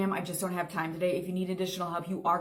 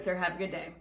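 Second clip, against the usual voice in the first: usual speaking voice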